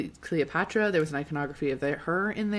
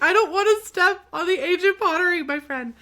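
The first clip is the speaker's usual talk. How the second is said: very high, loud protest; hammed-up high-pitched pleading; acted protest; 13 semitones higher, 8 dB louder than usual. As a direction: squeal the objection in mock distress. Acted protest to laughing guard.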